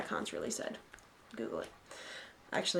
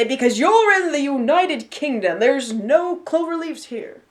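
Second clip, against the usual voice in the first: stern unionist voice, higher and very drawn out; Alan; 4 semitones higher, 3 dB louder, slower than usual. Retold or imitated. Co-host mimicking a unionist friend's objection, slow declaiming. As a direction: declaim it slowly, word by word. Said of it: "Because as Alan would say".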